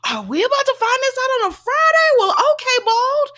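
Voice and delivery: high, loud, mock-indignant squeal; a high, loud, put-on voice of disbelief; exasperated quote. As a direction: squeal it out like an outraged fan. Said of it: "And I said".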